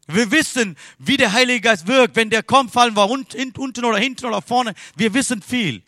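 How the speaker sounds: high, loud sermon delivery; full-force preaching. Real, not acted. Preacher chiding congregation for knowing too much, full-force preaching.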